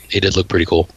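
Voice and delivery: normal talking voice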